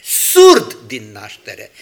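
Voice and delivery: ordinary talk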